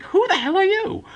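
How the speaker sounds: high, loud startled outburst; shocked high yell at the intruder; stunned shock. Retold or imitated. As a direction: blurt it out in total disbelief.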